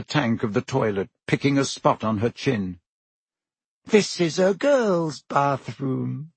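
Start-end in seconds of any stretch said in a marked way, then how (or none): 3.8-6.3 slow, high-pitched wailing ghost voice; suspicious ghost. A narrator's reading. Moaning Myrtle eyes intruders in her bathroom, suspicious. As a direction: draw it out, high and aggrieved.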